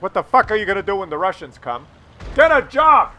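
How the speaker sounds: building to a loud, high shout; imagined rant. Pretend. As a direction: blow up at them, ending in a yell. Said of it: "I didn't say, like".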